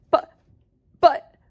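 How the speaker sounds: high, halting alarm, slow; panicked stammer. Acted. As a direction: sputter in disbelief. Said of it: "shouted Grandpa Joe".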